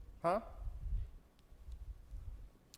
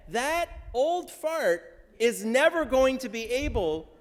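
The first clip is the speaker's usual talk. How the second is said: mock indignant Sarah; 8 semitones higher, 6 dB louder than usual. Teacher voices Sarah's blunt retort.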